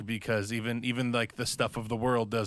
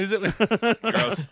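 high, laughing "gross"; laughing disgust; 9 semitones higher, 5 dB louder, slower than usual. Real, not acted.

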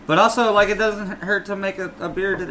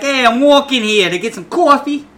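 loud, high, hammed-up New Yorker; brash New York caricature; New York accent; 8 semitones higher, 7 dB louder than usual. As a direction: bellow it like a mock New York pedestrian. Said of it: "That's what the New Yorkers say".

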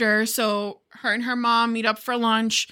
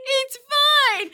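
high mock reassurance; 13 semitones higher than usual. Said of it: "being like".

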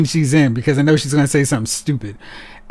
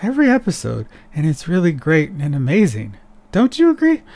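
mocking Danielle Panabaker. Vlogger mocking the actress's line delivery to his viewer. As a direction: parody an actress's breathy-sweet line reading, tossing the pitch up. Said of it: "says her dialogue like this".

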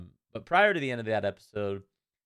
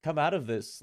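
much higher, lightly voiced innocent girl; a hint of a pleading little girl, higher pitched; 9 semitones higher, 4 dB louder than usual. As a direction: play a sincere young girl who can't believe it. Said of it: "She's like".

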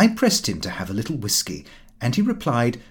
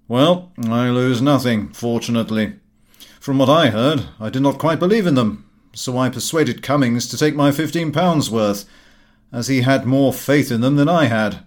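a hint of a hearty friend, louder; breezy family friend teasing, lightly voiced; lightly voiced Gowing; 5 dB louder than usual. A narrator's reading. Friend teasing Lupin about the loss, lightly voiced.

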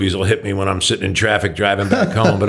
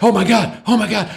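mock disbelief, pitched much higher; retold excitement; 10 semitones higher than usual. Comedian reacting to Carlin's voicemail, excitedly higher. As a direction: gush like a young comic who just got the call. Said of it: "he was like".

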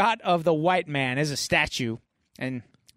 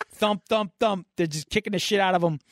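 ordinary talk

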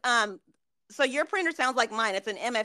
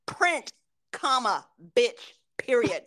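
slow, word-by-word dictation with punctuation; deliberate command; slower than usual. Shown as a demonstration. Worker orders a stubborn printer, slow and deliberate. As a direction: spell out the order slowly and firmly. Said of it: "this is how I'm like".

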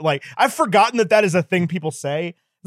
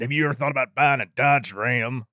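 Sam Elliott; 3 semitones lower than usual. Acted. Host spoofing a truck ad, Sam Elliott impression. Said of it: "people doing the Sam Elliott impression".

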